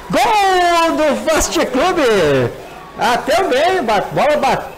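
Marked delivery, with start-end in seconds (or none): 0.1-2.5 long drawn-out goal shout; goal call. Real, not acted.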